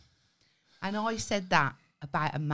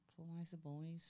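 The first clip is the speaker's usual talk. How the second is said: much softer, muttered aside; quiet repeat; 3 semitones lower, 22 dB quieter than usual. Real, not acted. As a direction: drop it to a near-mutter, as if to yourself.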